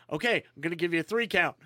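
quick rushed countdown; fast countdown. Retold or imitated. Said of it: "he goes".

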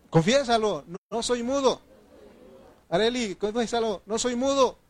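high, insistent declaration; rousing prompt. Real, not acted. Preacher prompting church members to confess aloud, high and rousing. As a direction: urge them to say it out loud.

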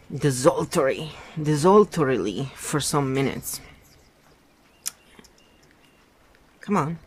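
steady read-aloud novel narration; story narration. A narrator's reading. Reader narrating a novel chapter aloud, in storytelling voice. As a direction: read the book to your listeners, evenly.

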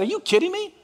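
high, disbelieving outcry; incredulous. Retold or imitated. Preacher balking at Joseph's mercy, incredulous. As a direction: balk at the verse in mock disbelief. Preacher voicing his own outrage.

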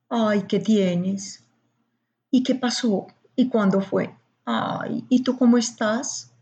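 slow, gentle questions full of concern; consoling tone. Shown as a demonstration. To a very sad friend, slow consoling concern. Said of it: "bajo, suave".

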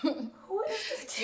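high, slow, laughing disbelief; retold astonishment. Host recalls watching the guest rip it on stage, amused astonishment. Host retells her stunned reaction.

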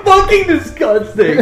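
high laughing squeal of disgust. Real, not acted. Reacting to Aaron's Pepto lip gloss.

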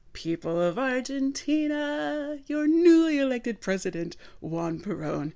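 hammed-up political speech to the crowd; grand balcony speech. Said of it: "I don't know where that came from".

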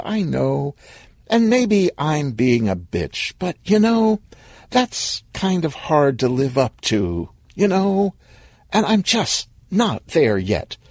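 high, hesitant let-down; reluctant, pained honesty; apologetic girlfriend. Acted. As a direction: let him down gently with lifted, questioning phrases. Woman apologetically rejects boyfriend.

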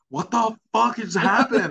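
high, loud, slow, laughing disbelief; laughing mock panic. Retelling waking up bloody, laughing panic. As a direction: squeal it through laughter, stunned. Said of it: "And I was like".

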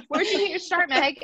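high, lightly put-on interviewer. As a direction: play a chat show host. Imagined interviewer and answer.

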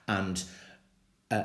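normal talking voice

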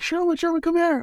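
high excited calling voice; mother calling. Retold or imitated. Mother calling Sherwin from the kitchen, high and eager.

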